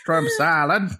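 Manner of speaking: Cajun Dalek voice. Acted. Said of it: "He's just listing off kinds of shrimp".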